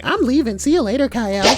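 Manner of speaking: high, loud mock girl voice; imitating Rhea. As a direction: mimic her leaving, high and loud. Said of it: "Rhea's all like".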